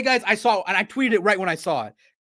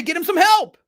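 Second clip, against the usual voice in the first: much higher, louder insistence; emphatic demand; 10 semitones higher, 6 dB louder than usual. Retold or imitated. Telling the team to get him help, loud and high. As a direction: bark it out like a demand. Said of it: "I say".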